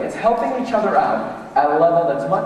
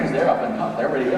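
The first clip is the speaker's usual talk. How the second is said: usual speaking voice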